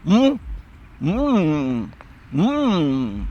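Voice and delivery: mock mute groaning hum; mute man's humming. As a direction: hum like a man who cannot speak. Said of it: "O cara era mudo, não conseguia falar".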